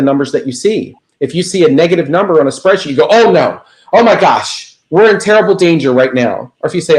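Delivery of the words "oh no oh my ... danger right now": mock panic. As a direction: play the overreacting worrier, half joking.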